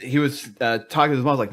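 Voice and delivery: ordinary delivery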